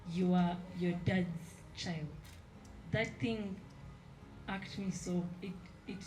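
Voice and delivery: normal talking voice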